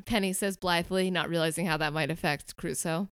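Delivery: ordinary talk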